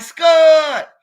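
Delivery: loud, high yell of a name; shouting out. Retold or imitated. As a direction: call out to him across the block. Recognizes friends shooting, calls out.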